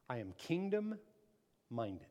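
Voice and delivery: slow, soft, measured statement; understated declaration. Shown as a demonstration. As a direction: say it slowly and quietly, word by word. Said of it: "say it like you mean it".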